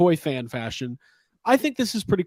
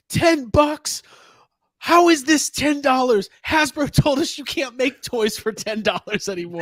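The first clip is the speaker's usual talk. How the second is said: mock outraged fan; 8 semitones higher, 3 dB louder than usual. Mocking toy fans amazed at the price, higher mock disbelief. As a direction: play the flabbergasted collector over the price. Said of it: "all the toy fans going".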